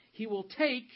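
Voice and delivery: "take" hit high and loud; stress on take. Shown as a demonstration. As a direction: land hard on the word "take". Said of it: "did you notice the emphasis on the word".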